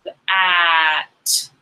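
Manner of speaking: "The" then "at", split apart; sound by sound. Shown as a demonstration. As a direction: segment "The, at" on the arm. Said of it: "Do our arms".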